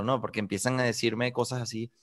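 ordinary talk, nothing marked

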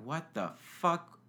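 slow, drawn-out disbelief; stunned what the fuck. Retold or imitated. Waking up alone, asking himself in slow disbelief. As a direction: let the confusion stretch each word. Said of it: "And I was like".

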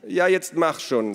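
high, loud hurry-up; exasperated prodding, pitch up; impatient urging. A narrator's reading. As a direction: push them to get on with it.

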